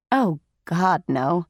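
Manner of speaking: low raspy smoker's voice; lightly voiced raspy, sultry mother; deep raspy voice. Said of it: "in her deep, raspy voice".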